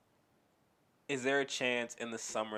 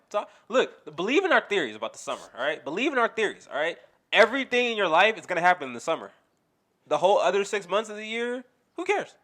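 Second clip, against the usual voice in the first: loud, high, rapid-fire declarations; worked-up comic sermon; hyped ranting cadence; 7 semitones higher, 7 dB louder than usual. Real, not acted. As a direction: preach the summer theory at a yell. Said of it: "saying words in this tone and cadence".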